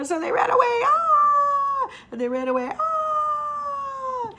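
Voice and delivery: high loud frightened cries; loud mock fright; panicked screaming. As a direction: scream and run. Dogs flee screaming.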